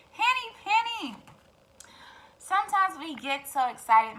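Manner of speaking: plain reading voice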